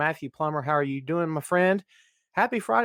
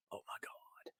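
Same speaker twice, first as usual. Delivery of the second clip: whisper; 27 dB quieter than usual. Real, not acted. Aside under breath.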